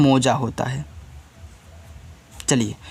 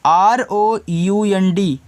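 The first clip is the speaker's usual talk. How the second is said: letters spelled slowly; आर ओ यू एन डी one by one; slow spelling; 3 semitones higher, much slower than usual. Shown as a demonstration. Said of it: "इसको पढ़ोगे round". (धीरे धीरे spelling)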